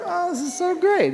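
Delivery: high, gushing burst of delight; thrilled gush. Retold or imitated. Recalling the magazine feature, a high thrilled gush. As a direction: squeal it like great news just landed. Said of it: "I was like".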